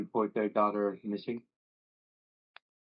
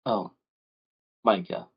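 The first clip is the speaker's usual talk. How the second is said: weary mother; 3 semitones lower than usual. Acted. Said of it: "and says".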